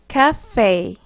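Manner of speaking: cafe said the English way, with an f; English pronunciation. Shown as a demonstration. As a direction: model cafe in English for learners. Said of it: "발음하시면 됩니다". (cafe를 영어식으로 발음)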